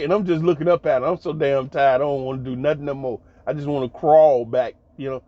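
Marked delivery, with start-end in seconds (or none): none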